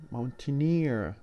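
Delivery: "mountaineer" with a drawn-out stressed EER; slow down and lean on "eer" in "mountaineer"; stress on the suffix. Shown as a demonstration. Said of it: "the stress on the suffix itself".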